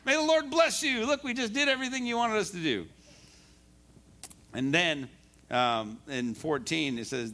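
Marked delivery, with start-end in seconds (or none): 0.1-2.9 all excited. Acted. Preacher acts Saul reporting to Samuel, all excited. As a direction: burst in high and proud of yourself. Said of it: "He's all excited".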